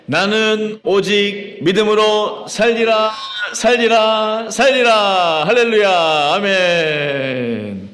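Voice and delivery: high, loud declaration in drawn-out phrases; loud rallying cry. Real, not acted. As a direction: shout it out to lead the congregation. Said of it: "우리 큰 목소리로 외쳐보도록 하겠습니다".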